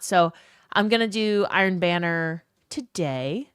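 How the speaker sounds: ordinary talk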